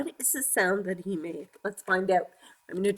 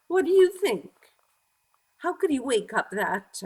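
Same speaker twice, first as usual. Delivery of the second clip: usual speaking voice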